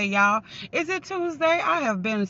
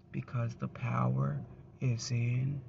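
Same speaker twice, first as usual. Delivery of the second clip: dramatic hushed proclamation; 10 semitones lower, 12 dB quieter than usual. Acted. Dramatic proclamation, sudden drop.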